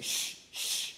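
two unvoiced shushes; impatient shushing. Crowd kicks the beggar and hushes him, impatient shushing. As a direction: shush someone sharply, twice.

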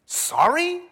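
high, sharply rising question; acted disbelief at a cheap apology; incredulous echo. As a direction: throw the word back in disbelief. Acted reader scoffs at Saul's apology.

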